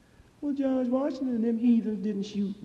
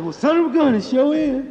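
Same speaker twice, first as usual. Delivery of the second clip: owner's surprised reply; 8 semitones higher, 9 dB louder than usual. Acted. Owner voiced in story.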